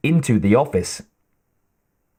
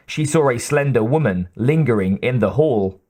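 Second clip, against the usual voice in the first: ordinary delivery, nothing marked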